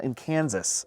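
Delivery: normal talking voice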